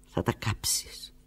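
slow, breathless whisper. Acted. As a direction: breathe the words into the receiver. Whispered on the phone.

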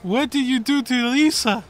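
high, loud Wiseau-style outburst; hammed-up Tommy Wiseau impression. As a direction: wail it like Tommy Wiseau in The Room. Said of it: "You almost went a little Tommy Wiseau there".

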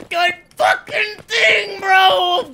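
slow, high raging yell; furious shout. Real, not acted. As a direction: scream it out in frustration. Raging after dying in game.

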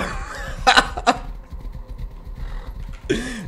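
high airy giggle; breathless laugh. Real, not acted. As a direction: laugh helplessly. Laughs at chase.

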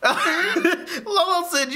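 high, slow laughing words. Real, not acted. Vlogger laughing at the retold Lolo remark. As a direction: break into laughter mid-sentence.

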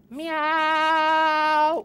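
high little meow; cat meow. Acted. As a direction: answer like a stubborn kitten. Cat still refuses.

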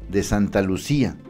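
ordinary talk

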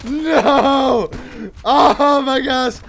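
high, loud cry of dismay; panicked yelling. Real, not acted. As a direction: yell as you nearly get killed. Losing the fight.

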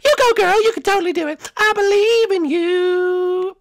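loud, high cheer, drawn out at the end; root for your friend at full volume; rousing girlfriend pep cheer. Acted.

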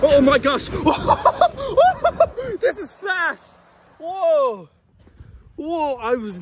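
high, laughing thrill; excited. Real, not acted. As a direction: squeal through the fast ride. Racing downhill on sled.

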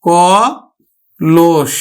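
ক then লোস, split apart; teacher spells out the sound of কলস; syllable by syllable demo. Shown as a demonstration. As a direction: break কলস into ko and losh. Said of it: "মধ্য আটা ওকার হয়ে যাবে".

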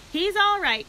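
high, loud anxious reassurance; anxious cry. A narrator's reading. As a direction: call out over the crowd that he's fine. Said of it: "cried Mister Zuckerman".

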